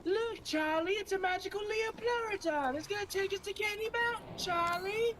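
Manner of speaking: high, sing-song cartoon unicorn; Candy Mountain unicorn voice. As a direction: coax Charlie along in a high voice.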